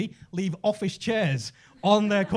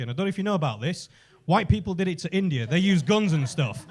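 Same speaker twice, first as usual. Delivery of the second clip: normal talking voice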